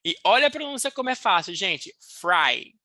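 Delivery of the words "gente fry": ordinary talk, nothing marked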